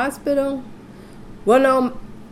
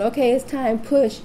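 lightly voiced doctor, higher pitched; imitated doctor; 5 semitones higher than usual. Doctor telling another woman to push, imitated in higher voice. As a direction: raise the pitch for a quoted coaching call.

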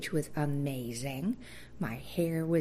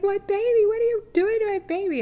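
very high, loud frantic cry; frantic mother's wail; 10 dB louder than usual. Retold or imitated. New mother watching nurses scrub her baby, a loud frantic cry. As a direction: wail it like a terrified new mom. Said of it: "I'm like".

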